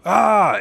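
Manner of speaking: high, loud mock scream; short acted shriek of alarm. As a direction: imitate a crowd's panicked scream. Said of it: "Everybody is screaming".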